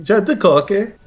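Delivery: exaggerated learner's Norwegian accent; put-on Norwegian accent. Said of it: "Why do you put an accent on".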